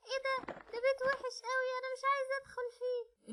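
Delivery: put-on high small girl's voice, tired and scared; little girl. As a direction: whine like a worn-out little girl afraid of the dark. (بنت صغيرة تعبانة وخايفة، صوت عالي ورفيع)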